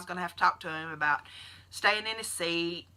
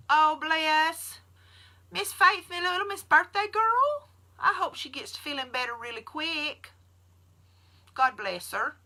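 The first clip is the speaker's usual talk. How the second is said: high, sweet read-aloud voice; lightly voiced sweet commenter; 9 semitones higher than usual. Reading a viewer's sweet comment, high and bright. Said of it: "you had made the comment".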